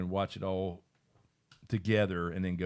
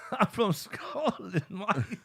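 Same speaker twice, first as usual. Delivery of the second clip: lightly Scottish, high and slow; mock Scottish accent; 10 semitones higher, much slower than usual. Host jokes in a mock Scottish accent, high and slow. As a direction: drawl out a hammed-up Scots line. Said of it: "Scotland is like".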